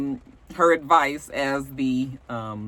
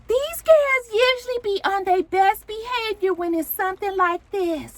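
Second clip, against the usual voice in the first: much higher put-on voice imitating a castmate; mock phone-call voice. Castmate reassuring someone on the phone about the girls, high mock voice. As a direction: pitch it way up, sustained mimicry.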